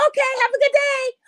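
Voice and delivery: high, breezy send-off from a parent; mock oblivious parent. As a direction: imitate a parent waving the kid off.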